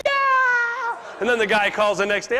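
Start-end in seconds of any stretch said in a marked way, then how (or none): 0.0-1.0 high, squealing mock cheer of a too-eager rookie; overexcited cheer. New guy thinks he's closed a deal, mock thrilled cheer. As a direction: ham up the rookie's delight. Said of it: "How many new guys get too excited?".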